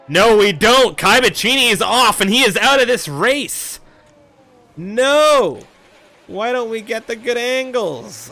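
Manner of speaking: loud, high, shocked race call; yelling as the car goes off; excited motorsport commentator. Real, not acted. Crash seen during live commentary.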